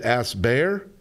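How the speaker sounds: ordinary delivery, nothing marked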